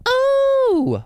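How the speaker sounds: very high, loud falsetto ooh. Retold or imitated. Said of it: "we're doing another [name] bit".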